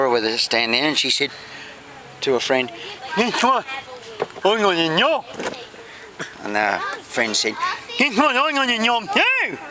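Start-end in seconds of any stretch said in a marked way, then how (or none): 3.2-5.2 high, garbled character voice; joke character Vicky. Acted. Vicky tells a friend her news, high garbled voice. 8.0-9.6 high, mush-mouthed friend; garbled character voice. Acted. Friend shares her own job news, high garbled voice. Friend echoes in same funny voice.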